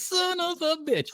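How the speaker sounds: put-on very high, slow kid voice; imitated little boy cursing. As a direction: draw out the curse in a small high squeal. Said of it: "He goes".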